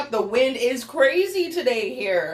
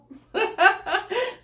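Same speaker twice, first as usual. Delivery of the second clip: high laugh; 11 semitones higher than usual. Real, not acted. Laughs after robot game.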